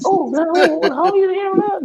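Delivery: high excited voice greeting the news; happy surprise. Retold or imitated. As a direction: yelp it like you can't believe it. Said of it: "I'm happy, like".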